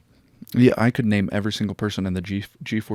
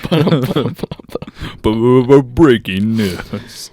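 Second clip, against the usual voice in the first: slow loud mock news stutter; news anchor; 3 semitones higher, 10 dB louder, much slower than usual. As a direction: announce breaking news, dragged out. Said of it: "news intro".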